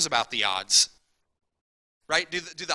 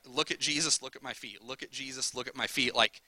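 ordinary talk

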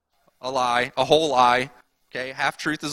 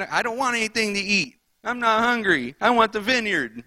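put-on whiny pouting child voice, pitched up; sulky toddler; 6 semitones higher than usual. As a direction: pout it out like a toddler refusing dinner. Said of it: "This sounds like a toddler".

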